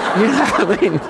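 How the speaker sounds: chuckling through the joke; amused laughing aside. Real, not acted. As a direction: laugh at your own jab. Joke to second-language speakers.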